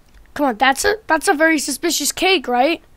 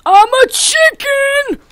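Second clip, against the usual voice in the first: high, slow squawky cry of I'm a chicken; chicken shout; 13 semitones higher, 6 dB louder than usual. Acted. Gamer yells at the hunter, high squawking chicken shout. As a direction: belt it out like a proud chicken.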